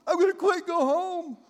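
high, loud mock tantrum; whiny quitter.

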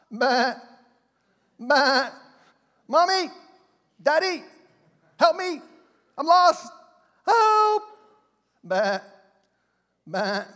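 put-on high, loud bleating lost sheep and child calling for help; squealing lost lamb calling Mommy and Daddy; lost sheep crying. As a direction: cry out like a lost little lamb. Preacher acts lost sheep crying out.

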